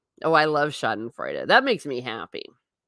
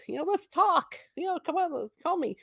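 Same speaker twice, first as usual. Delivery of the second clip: high mock pleading voice; lightly voiced friend begging to talk; playful imitation of a pushy caller; imitating a friend; 12 semitones higher, 4 dB quieter than usual. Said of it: "someone who shall remain unnamed was like".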